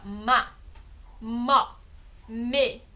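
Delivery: "Ma", "mo", "me" sounded out one by one; syllables ma mo me. Shown as a demonstration. Teacher sounding out Korean syllables for students, one at a time. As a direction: spell out "Ma, mo, me" as separate syllables with a pause between each.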